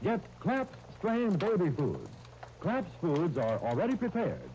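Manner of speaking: normal talking voice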